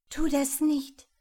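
höher und lauter, flehend warnen; besorgte Warnung. Acted. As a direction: ihn besorgt zurückhalten. Die Schönste warnt Ferdinand. (hohe, besorgte Frauenstimme)

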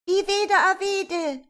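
put-on high, loud, slow children's shout. A narrator's reading. Children shouting their choice of story, loud high call. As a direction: yell it like a child picking a story. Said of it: "schrien einige".

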